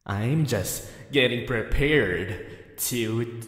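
usual speaking voice

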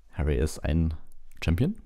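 ordinary delivery

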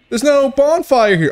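loud, high-pitched panic; real yelp of alarm; alarmed outcry. Real, not acted. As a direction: yell it out high in dismay. Alarmed at missing bonfire.

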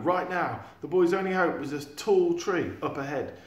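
ordinary delivery

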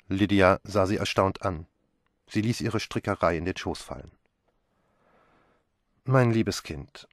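plain reading voice

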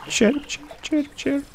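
high chirping bird imitation; parakeet chatter. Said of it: "Oh, birdie".

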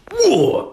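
high gasping "whoa"; anime grunt. Shown as a demonstration. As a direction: cry out as if punched in the stomach. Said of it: "you have to make these noises".

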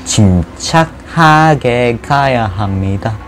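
drawn-out sneaky creeping; slow careful narration. Pretend. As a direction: stretch every word, tiptoeing along. Creeping carefully through the level. (살금살금 느릿하게)

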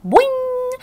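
very high twangy boing, like a snapped guitar string; frog boing. A narrator's reading. Frog leaping at the hamster, a loud boing. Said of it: "He sounded like a broken guitar string".

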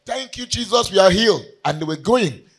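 fervent sermon, rising to shouts; preaching. Acted. Preacher urging the church toward gratitude, fervent. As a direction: preach it out to the congregation.

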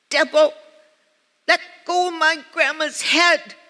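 put-on high, loud voice of a tiny child; wee little child's voice. Granddaughter orders the devil off grandma's head, wee little voice. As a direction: imitate a toddler giving a firm order. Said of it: "I hear this wee little voice saying".

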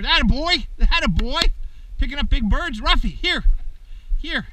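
high, loud praise for the dog; excited praise. Real, not acted. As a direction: cheer him on as he brings the bird. Praising puppy carrying bird.

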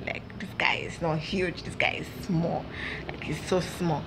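hushed whisper, spilling a secret; whispering to girlfriends about a guy; gossipy whisper. Retold or imitated. Girls gossiping, voice drops.

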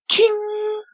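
high ringing "ching"; high-pitched metallic chime imitation; imitated chain ring. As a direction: mimic a hammock chain ringing out. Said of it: "a chain sound".

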